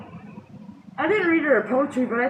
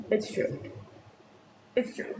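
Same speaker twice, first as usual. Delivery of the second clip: much softer It's true; quiet repeat; 3 semitones lower, 9 dB quieter than usual. Shown as a demonstration. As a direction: drop the voice on the repeat. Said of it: "and it's true".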